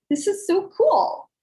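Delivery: excited. Retold or imitated. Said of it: "I'm like".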